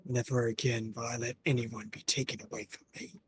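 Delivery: lightly voiced determined character. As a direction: vow it with quiet resolve. Voices game character dialogue.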